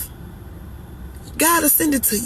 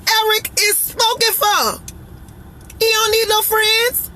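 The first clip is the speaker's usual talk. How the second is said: emphatic scolding; 6 semitones higher than usual. Real, not acted. Scolding imagined flirt.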